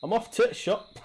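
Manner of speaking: high, lilting imitation of a Geordie dad; light Geordie accent impression. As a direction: mimic your Newcastle dad going shopping. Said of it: "my dad does that. He's from Newcastle".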